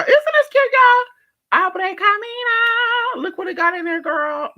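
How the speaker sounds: very high, loud, slow squeal; cute squealing voice. Real, not acted. Vlogger shows viewers a cute candle, high squeal.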